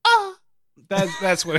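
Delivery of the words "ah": a sudden high, loud mock moan of pleasure; hammed-up climax cry, much higher and louder; over-the-top fake moan, pitched way up; mock orgasm moan. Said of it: "they orgasmed".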